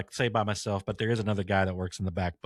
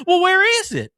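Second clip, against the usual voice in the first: Lady Boomhauer; 10 dB louder than usual. Retold or imitated. Customer demands a piano in a high, loud Boomhauer voice. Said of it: "she sounds just like".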